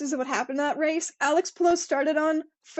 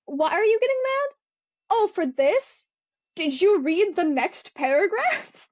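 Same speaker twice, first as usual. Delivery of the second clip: higher-pitched, exasperated questioning; mock incredulous; 5 semitones higher, 3 dB louder than usual. Podcaster to fans who skipped the next paragraph, mock incredulous. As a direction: question them in rising, put-on disbelief.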